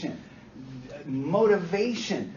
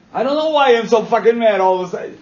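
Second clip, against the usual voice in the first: loud, higher "I don't know why I'm so fucking mad"; acted client outburst; 4 semitones higher, 13 dB louder than usual. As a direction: shout it like a scared, furious client. Voicing a confused, angry client.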